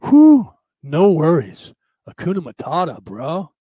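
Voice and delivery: hammed-up California surfer bro; laid-back surfer. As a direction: drawl it like an easygoing surfer. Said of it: "surfers talk slow, and they're laid back".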